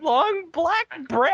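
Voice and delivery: high, slow squeaky disbelief; squeaky mock question. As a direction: squeak the words in silly disbelief.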